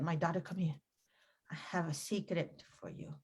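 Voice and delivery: hushed, confiding mother voice; mom's explanation. Retold or imitated. Mom confiding in her daughter at a party, hushed. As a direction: softly let her in on a secret. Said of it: "this is how my mom explained it".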